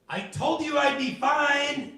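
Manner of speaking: high, loud told-you-so; triumphant grandma. Retold or imitated. Grandma answering her worried grandson, high loud told-you-so. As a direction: crow it like a smug granny.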